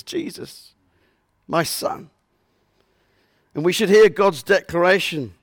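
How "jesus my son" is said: near-whispered, much softer, as God naming his son; hushed voice of God. Acted. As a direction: breathe the words out gently as God. Preacher voices God softly.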